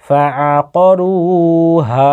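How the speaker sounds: রু and হা held long, ফা আ ক kept short; drawn-out vowels. Shown as a demonstration. Teacher showing two madds, রু and হা drawn out. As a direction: stretch only রু and হা. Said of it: "হায়ে আলিফ মাদ্দা যুক্ত রয়েছে".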